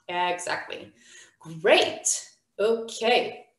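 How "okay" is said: usual speaking voice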